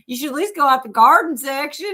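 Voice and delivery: Southern accent imitation. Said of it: "the Southern accent".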